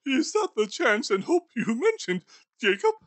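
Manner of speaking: put-on a nervous old skinflint questioning a ghost; Scrooge voice.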